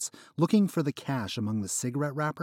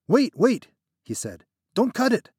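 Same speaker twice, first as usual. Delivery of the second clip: much higher, louder panicked plea; panicked protest; 12 semitones higher, 9 dB louder than usual. Acted. Trapped friend panicking at the knife. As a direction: stop him in a panic.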